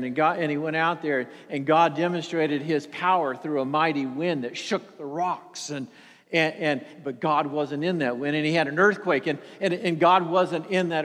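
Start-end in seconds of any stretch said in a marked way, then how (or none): none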